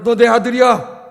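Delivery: mother's firm reply. Retold or imitated. Mother reassuring son.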